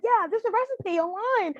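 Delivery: high-pitched, eager mock blurting; retold frantic excitement. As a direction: imitate yourself frantically chiming in. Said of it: "frantically talking".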